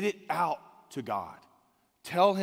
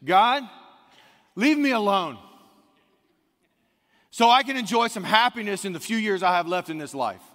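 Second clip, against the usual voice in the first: pleading demand; 4 semitones higher, 7 dB louder than usual. Acted. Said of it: "He's saying this".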